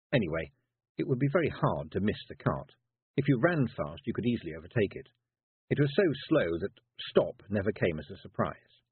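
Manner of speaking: plain reading voice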